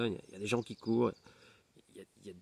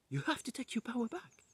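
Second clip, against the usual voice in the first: much higher, softer echo of the neighbour's words; high echoed advice; 13 semitones higher, 5 dB quieter than usual. Retold or imitated. The neighbour's advice echoes back, high and soft. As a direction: lift it up high like an inner voice. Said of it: "ma voisine qui me dit".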